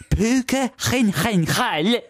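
high, slow POO-ka khin-khen-KHAL for Puka Gyllenhaal; absurd mispronunciation. Pretend. Co-host clowning with silly rule, Puka Gyllenhaal high and guttural. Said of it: "The J is pronounced p".

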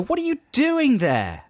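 slow, high-pitched teasing question; drawn-out, put-on astonishment; mock bewilderment. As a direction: act baffled at finding your girlfriend there. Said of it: "and then go".

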